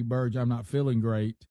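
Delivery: ordinary talk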